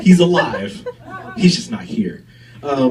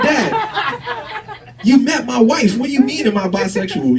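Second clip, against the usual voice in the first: higher, laughing exasperation; exasperated son; 8 semitones higher, slower than usual. Retold or imitated. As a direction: plead with dad in disbelief. Exasperated reply to father.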